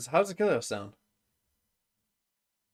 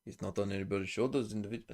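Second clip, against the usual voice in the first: low, softer quote voice; put-on player voice; 4 semitones lower, 8 dB quieter, faster than usual. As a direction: try out a player voice, pitched down and softer. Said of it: "Kiko doesn't sound like that either".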